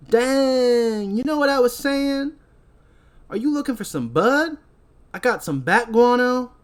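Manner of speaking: high, drawn-out stoner pitch; high put-on hustler voice, stretching the line ends. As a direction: sell it in a hammed-up high voice.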